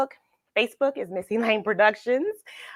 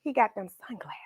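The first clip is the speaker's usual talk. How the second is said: soft unvoiced whisper; hushed storyteller whisper; whispered aside; 8 dB quieter, faster than usual. A narrator's reading. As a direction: let the kids in on a secret.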